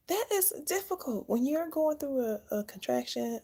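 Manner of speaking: high-pitched emphasis, easing down; emphatic high pitch. Real, not acted. New mom recalling holding still through contractions, high emphatic voice. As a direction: start way up high, then settle. Stressing how hard it was.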